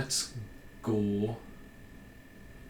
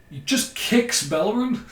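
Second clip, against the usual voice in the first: incredulous outburst; 11 semitones higher, 7 dB louder than usual. Real, not acted. Watching Rooney's foul replay.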